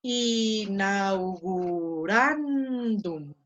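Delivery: "Inaugurandum" stretched very slowly syllable by syllable; slow careful word. Shown as a demonstration. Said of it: "Muy bien". ("Inaugurandum" muy despacio)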